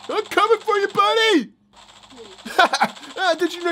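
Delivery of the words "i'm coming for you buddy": pitched way up, teasing the enemy; sing-song threat to a toy villain; playful high-voiced threat; high mock taunt.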